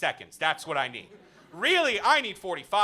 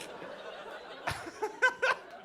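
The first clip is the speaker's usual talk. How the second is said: high breathy laugh; 6 dB quieter than usual. Real, not acted. Laughing at own joke.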